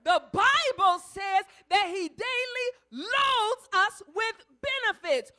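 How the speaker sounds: the repeat cried out loud and slow; loud preaching. Acted. Preacher repeating scripture to the congregation, now full-voiced. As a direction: throw the line out with full preaching force. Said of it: "He doesn't add while supplies last".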